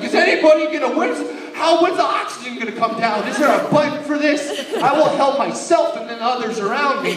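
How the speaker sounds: loud, high, panicked babble; frantic, shouting to nobody in particular; freaking out. Retold or imitated. Kid retelling his plane panic, loud and frantic. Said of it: "And I'm like".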